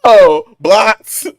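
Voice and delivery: loud, high no then blocked; loud refusal. Real, not acted. Cohost loudly rejects the suggested date. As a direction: shut it down loudly. Reacting to MAGA date offer.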